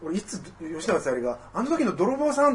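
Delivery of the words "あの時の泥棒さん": higher, lightly voiced actress recognizing the thief; a light mock of her surprised line; a hint of an actress's startled recognition; imitating Sayuri Yoshinaga. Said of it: "とかってさ、言い出すか".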